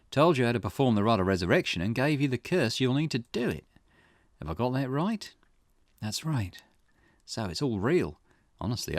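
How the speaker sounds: lightly voiced investigator, intrigued; Richter. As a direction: think aloud as a curious detective, lightly acted. Voices Richter pondering the curse.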